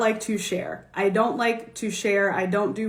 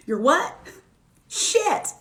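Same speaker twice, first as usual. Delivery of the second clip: "Shit." shot way up high; shocked disbelief; 5 semitones higher, slower than usual. Retold or imitated. Hearing the roommate is leaving, shocked. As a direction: gasp it out in shock. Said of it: "I'm sorry".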